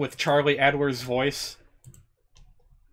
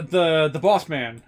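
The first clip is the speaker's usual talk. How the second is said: usual speaking voice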